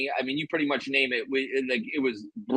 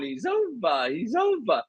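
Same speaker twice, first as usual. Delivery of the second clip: mock voice crack; 8 semitones higher, slower than usual. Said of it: "my friends just kept going".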